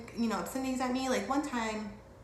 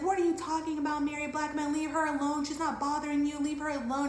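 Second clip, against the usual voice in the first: high-pitched indignant mock yelling; retold screaming; 7 semitones higher than usual. Friend defending her from a heckling stranger, retold high angry scolding. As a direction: go off on him, pitched up but not full volume. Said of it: "she starts screaming at him".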